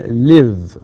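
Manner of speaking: slow, loud "live" with a held buzzing v; voiced final V. Shown as a demonstration. Teacher giving an example of a voiced ending, slow model. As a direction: stretch "live" and voice the last sound. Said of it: "مثل كلمة".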